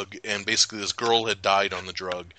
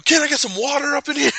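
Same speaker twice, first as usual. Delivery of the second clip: mock [name]; 5 dB louder, faster than usual.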